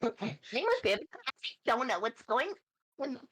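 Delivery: high airhead bimbo voice. Pretend. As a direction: play the ditzy bimbo, high. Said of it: "love doing my bimbo voice".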